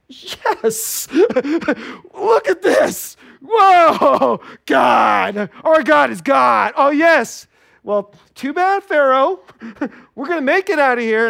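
high, loud, triumphant cheering; confident joy. Acted. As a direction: cheer like you already know you're safe. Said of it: "Some people walk through like this".

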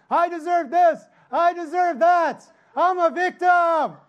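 mock a spoiled complainer, laughing; whiny entitled mock. Mocking entitled complaint on cross.

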